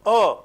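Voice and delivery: loud, isolated "A" as a schwa-like vowel; demonstrated vowel sound. Shown as a demonstration. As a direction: sound out "A" as one open-mid "uh", firmly. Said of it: "This sound".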